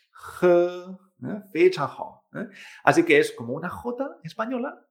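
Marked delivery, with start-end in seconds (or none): 0.1-1.0 J as a gentle, sustained h sound; very soft J. Shown as a demonstration. Teacher showing the Chinese H, J softened and held. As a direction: breathe out the J lightly, without scraping. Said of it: "pero bastante más suave".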